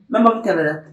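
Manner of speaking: usual speaking voice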